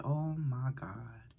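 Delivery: slow, hushed whisper; scared whisper. Real, not acted. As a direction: whisper it in dread.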